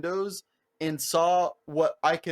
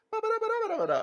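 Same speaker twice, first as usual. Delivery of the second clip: mock high chirpy server babble, much higher; high server singsong; much faster than usual. Server answering about horchata in a high singsong. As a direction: chirp the nonsense syllables way up high.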